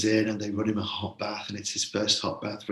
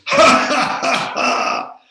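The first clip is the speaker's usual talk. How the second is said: booming, much higher, louder jolly lord's laugh; hearty laugh; 14 dB louder, much slower than usual. Acted. Sir Bertilac laughing at Gawain's worry, booming laugh. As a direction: throw your head back and roar with laughter.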